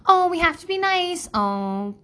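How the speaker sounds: high, sing-song scolding parent voice; preachy parent. Retold or imitated. Parent telling complaining child to be nice, sing-song. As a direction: chide a child with sugary politeness. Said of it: "we always say".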